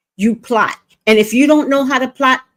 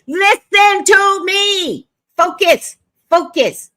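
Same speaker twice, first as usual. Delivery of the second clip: firm call to focus; 10 semitones higher, 3 dB louder, slower than usual. Retold or imitated. Coaching a crying girl.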